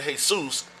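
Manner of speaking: "Jesus" said hay-SOOS; Spanish pronunciation. Shown as a demonstration. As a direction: say "Jesus" with an H sound, stress on soos.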